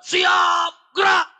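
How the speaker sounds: loud, high barked drill command; commander's shout. Shown as a demonstration. General commanding marching troops, firm loud shout. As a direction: snap the order like a drill sergeant. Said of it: "Memang harus teriak, tegas".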